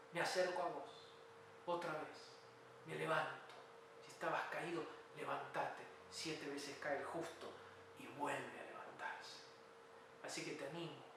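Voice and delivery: low, softened pastoral voice; subdued preacher's encouragement; prayerful closing. Real, not acted. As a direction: bring it down to an earnest, quiet appeal.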